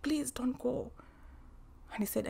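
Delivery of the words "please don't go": hushed, urgent begging; whispered plea. Retold or imitated. Wife whispers to her husband not to leave, whispered plea. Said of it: "I'm like".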